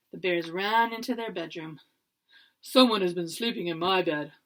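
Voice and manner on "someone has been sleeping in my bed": low, loud booming Papa Bear; big booming bear voice. A narrator's reading. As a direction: boom it out like a huge bear. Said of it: "Papa Bear bellowed".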